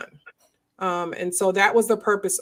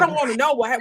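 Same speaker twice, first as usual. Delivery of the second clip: ordinary delivery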